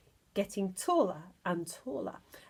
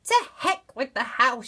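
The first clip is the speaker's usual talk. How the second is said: defiant shout; 3 semitones higher, 6 dB louder than usual. Acted. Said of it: "she shouted".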